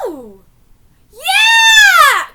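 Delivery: high, very loud triumphant yell; excited cheer. Real, not acted. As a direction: whoop it out. Cheering at the screen.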